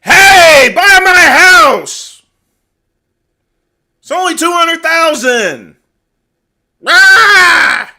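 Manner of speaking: over-the-top screaming house seller; mock screaming salesman. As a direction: yell it like a desperate street hawker. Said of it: "you're not supposed to scream at someone's like".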